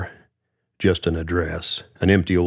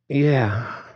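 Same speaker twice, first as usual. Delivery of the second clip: put-on lightly higher voice for the teenage daughter; a hint of a reluctant young woman; young daughter Susan; 8 semitones higher, slower than usual. A narrator's reading. Susan answers her dad.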